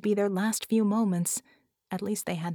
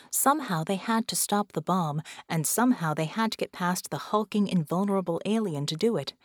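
ordinary delivery, nothing marked

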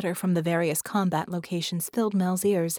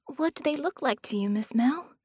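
put-on young girl, high and worried; little girl voice; 6 semitones higher than usual. A narrator's reading. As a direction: speak as an anxious little girl. Penny speaks.

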